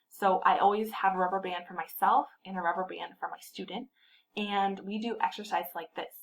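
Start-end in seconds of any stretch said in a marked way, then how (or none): none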